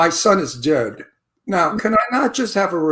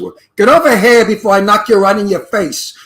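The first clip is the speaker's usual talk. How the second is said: louder Brooklyn tough-guy threat; Brooklyn talk; 7 dB louder than usual. Shown as a demonstration. Actor shows producers real Brooklyn talk, louder Brooklyn threat. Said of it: "Well, like Brooklyn talk".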